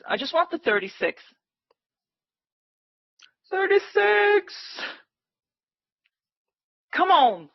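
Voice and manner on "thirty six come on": drawn-out, high pleading call; excited. Real, not acted. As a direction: stretch it out, begging the chart. Willing the price to hit target.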